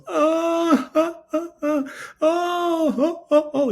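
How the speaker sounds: high keening wail of grief; wailing scream. Retold or imitated. Grieving husband wails over his dead wife. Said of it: "the dude yells out a big scream".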